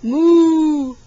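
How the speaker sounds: loud, long moo; playful cow imitation. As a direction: moo big to amuse a toddler. Mooing back to toddler.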